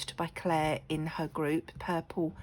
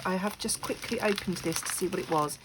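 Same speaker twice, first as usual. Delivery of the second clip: ordinary talk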